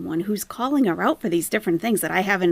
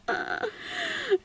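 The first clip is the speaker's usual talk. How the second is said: soft high giggle; 8 dB quieter than usual. Real, not acted.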